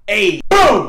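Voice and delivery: shouted hey boom; loud hey boom. Real, not acted. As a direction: blast it out. Emphatic shout.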